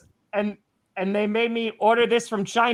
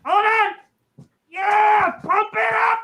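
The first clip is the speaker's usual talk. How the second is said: excited shout; 11 semitones higher, much slower than usual. Real, not acted. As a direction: pump up the crowd, yelling. Hyping himself up on show.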